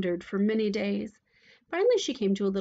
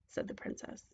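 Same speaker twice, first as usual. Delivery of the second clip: ordinary delivery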